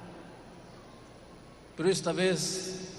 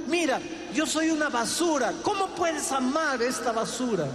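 anguished cry; 7 semitones higher than usual. Retold or imitated. Man cries out his confession.

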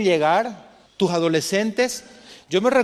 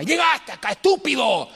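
higher, harsh mock yell at the son; angry yell; 5 semitones higher than usual. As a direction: play an angry father scolding. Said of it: "veía a mi papá gritando a mamá". (grito enojado de un padre imaginado)

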